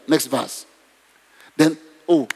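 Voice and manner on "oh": ordinary talk, nothing marked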